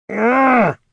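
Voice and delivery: a loud, high effortful growl; strained high groan. Acted. Jin straining to steer the spell, groaning growl. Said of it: "groaned the Noctomancer".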